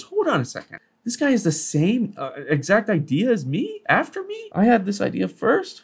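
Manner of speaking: high, startled "wait a minute" outburst; mock outrage. Real, not acted. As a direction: sound caught off guard that someone stole your idea. Notices a copycat of his idea.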